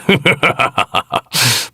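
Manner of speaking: falling scornful laugh; short contemptuous laugh; mocking laugh. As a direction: laugh down at the fool. Mocking a hopeless foe.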